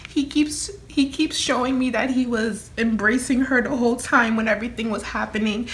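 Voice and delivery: fighting back tears while talking; teary-eyed. Real, not acted. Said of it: "I'm sorry I got teary-eyed".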